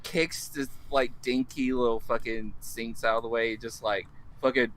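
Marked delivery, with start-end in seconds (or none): none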